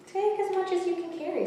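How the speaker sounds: high, quick little seahorse; a cheerful high sea creature offering help. A narrator's reading. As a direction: squeak it out brightly as the seahorse. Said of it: "said the seahorse".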